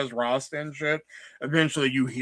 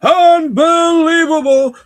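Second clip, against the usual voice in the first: very high, loud, slow squeal of Larry David; hammed-up Larry David outrage; Larry David impression; 15 dB louder, much slower than usual. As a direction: stretch it out in a shrieking Larry David whine. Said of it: "sounds like Larry David".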